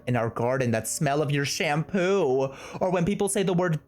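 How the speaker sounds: even, measured reading voice; love letter read aloud. Acted. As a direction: read a tender letter out evenly.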